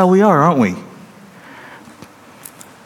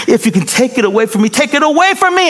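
anguished plea; 12 semitones higher, faster than usual. Acted. Jesus begging the Father in the garden, anguished rising plea. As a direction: beg desperately for the cup to pass.

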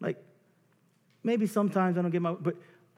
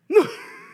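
big high burst of laughter; loud high laugh; 11 dB louder than usual. Real, not acted.

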